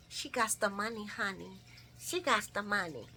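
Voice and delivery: soft singsong boast; hushed rhyming brag; sassy quiet brag. Real, not acted. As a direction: purr it like a rhyme.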